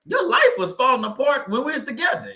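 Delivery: high-pitched, exclaimed comeback; indignant retort. Acted. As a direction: throw the plea back, voice shooting up. Acted retort to the ex's plea.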